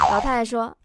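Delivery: ordinary talk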